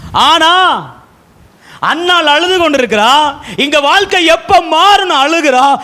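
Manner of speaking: full-force preaching. Acted. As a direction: preach it high to the whole congregation.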